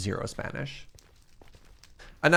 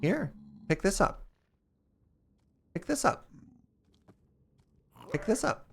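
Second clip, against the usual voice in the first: high, soft coaxing voice; sweet-talking a little pig; 7 semitones higher, 4 dB quieter than usual. Real, not acted. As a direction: coax the pig to take it.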